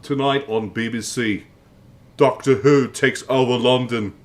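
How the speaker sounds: loud British TV announcer; hammed-up British broadcast voice; BBC voice. Shown as a demonstration. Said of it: "I wanna do my B B C voice".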